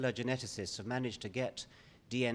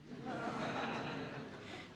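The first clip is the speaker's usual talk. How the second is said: laughing; 12 semitones higher, 8 dB quieter than usual. Real, not acted. Laughs at the lawyer joke.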